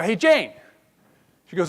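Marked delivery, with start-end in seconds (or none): 0.0-0.5 louder call of her name; calling across room. Retold or imitated. Speaker calls colleague across a room, raised call. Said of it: "I shout across the room".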